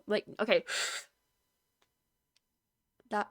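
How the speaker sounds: usual speaking voice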